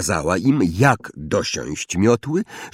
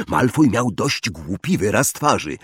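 usual speaking voice

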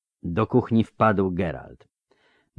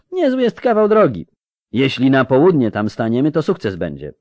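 loud, higher-pitched folksy lecturing; rustic steward; 7 semitones higher, 6 dB louder than usual. A narrator's reading. Fierabras lecturing Geralt about distances, animated rustic voice. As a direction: set the outsider straight in a provincial steward's voice.

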